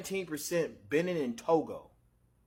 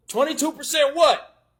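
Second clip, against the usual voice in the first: disbelief; 8 semitones higher, 9 dB louder than usual. Real, not acted. Reacting in disbelief to result.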